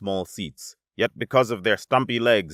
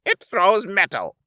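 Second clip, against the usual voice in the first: a huffy, raised little retort; huffed; 12 semitones higher, 5 dB louder than usual. Acted. Twin huffing at the alien's question. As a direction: snap it back, a bit put out. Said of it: "the other huffed".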